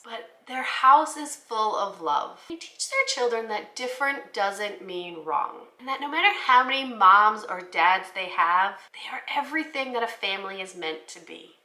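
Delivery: ordinary delivery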